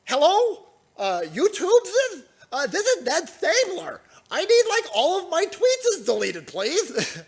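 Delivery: high whiny mock phone call; high pleading mock caller; mocking Ned.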